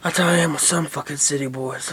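ordinary talk

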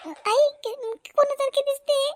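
high, choked-up question; weepy new mother; tearful. Acted. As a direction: ask through tears, overcome with feeling. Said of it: "रडते का तू?".

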